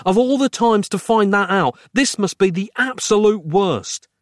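high, emphatic mock scream; exasperated scream. A narrator's reading. Narrator berating Roz over batteries, screaming mildly. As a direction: rant in disbelief at the timing. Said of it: "I screamed".

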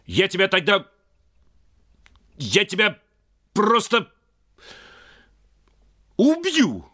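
rising loud, angry father; angry threat. Acted. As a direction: build to a furious threat.

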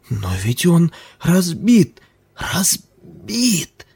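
high, breathless cry of protest; panting shaky eyewitness. A narrator's reading. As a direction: gasp it out, out of breath. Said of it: "задыхаясь".

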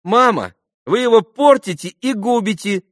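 put-on high, loud girlish protest; thin little voice. A narrator's reading. Lise scolds her mother from behind the door, thin high voice. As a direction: pipe up indignantly through a door. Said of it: "послышался тоненький голосок Лиз из-за двери".

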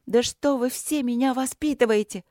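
put-on high, exasperated girl's outburst; angry retort. A narrator's reading. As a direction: flare up, pitched high. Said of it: "Со злостью вскинулась Каролинка".

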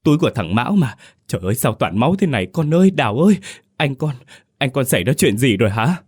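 put-on high fast panicked old woman; mother panicking over her son's bloody bag; tearful old mother. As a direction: rush the words out, near tears. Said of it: "Bà Giàng òa khóc theo Đào".

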